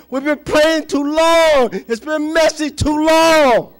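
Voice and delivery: loud, high exhortation, stretching line endings; full-force preaching. Real, not acted. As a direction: build to a shouted rallying cry for the church.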